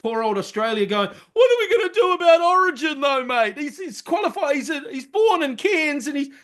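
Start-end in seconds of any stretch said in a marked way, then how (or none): 1.3-6.3 higher, fretting Australian voice; mock panicked Aussie. Mimicking Australia fretting over Origin, higher and worried. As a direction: play the worried Aussie officials.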